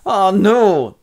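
high, loud mock wail of horror; hammed-up shocked outcry; dismayed cry. Mother reacts in dismay.

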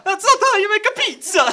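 high, loud hammed-up Italian accent; mock Italian chef. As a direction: scold like a cartoon pizza chef. Pizza line in Italian voice.

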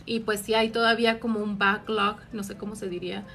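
ordinary talk

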